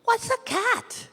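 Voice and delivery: high, small curious mouse voices; high-pitched little mice asking; mice children asking. Acted. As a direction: squeak the question like a tiny child.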